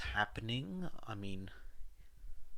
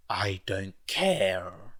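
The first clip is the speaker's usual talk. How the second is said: louder, slow, final; furious snap; 8 dB louder, much slower than usual. A narrator's reading. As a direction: bite off each word, louder and slow. Furious at Dabi.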